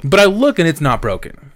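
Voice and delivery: usual speaking voice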